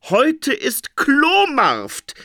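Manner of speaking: very high, slow, savoured delight; gleeful. A narrator's reading. Phoenix reading about the market, gleeful. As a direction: draw out the happy news.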